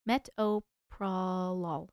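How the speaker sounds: metoprolol drawn out slowly, syllable by syllable; sounding it out. Shown as a demonstration. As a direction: stretch each part of metoprolol, low and slow. Trying out pronunciations, first take.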